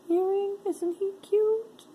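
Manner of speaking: cutesy voice. Pretend. Cooing over a bird earring.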